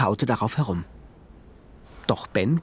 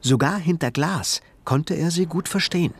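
ordinary delivery

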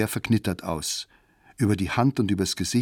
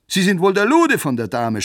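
much higher and louder jeering shout; 10 semitones higher, 7 dB louder than usual. Acted. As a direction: bellow the insult. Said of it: "schreit einer".

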